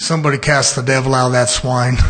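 loud, low-pitched command; defiant repeat. Acted. Defiantly repeats after the objection.

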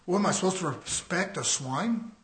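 usual speaking voice

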